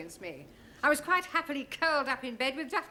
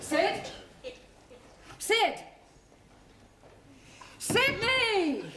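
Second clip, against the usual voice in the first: rising calls, getting louder; calling out; 9 semitones higher, 4 dB louder, much slower than usual. Acted. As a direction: shout for him across the building. Locked in, calling for caretaker.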